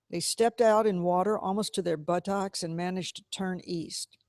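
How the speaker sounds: usual speaking voice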